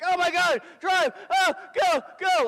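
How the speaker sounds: high-pitched panicked shouting; freaking out. Retold or imitated. Commander to driver after the blast, freaking out. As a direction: yell frantic orders at the top of your voice. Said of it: "my vehicle commander is just freaking out".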